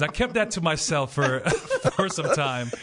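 usual speaking voice

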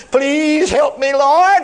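hammed-up crying out to the Lord; mock whining plea. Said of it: "instead of whining and crying".

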